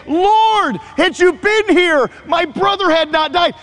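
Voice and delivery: high, pleading and held high to the end; Mary pleading. Retold or imitated. Pastor voices Mary repeating her sister's reproach, high plea. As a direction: voice Mary's grief, staying high. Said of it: "Mary says the exact same thing".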